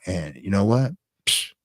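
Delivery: usual speaking voice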